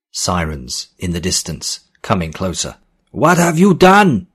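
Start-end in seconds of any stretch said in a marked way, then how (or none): 3.1-4.3 high, loud alarmed demand; demanding shout. A narrator's reading. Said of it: "demanded Vargas".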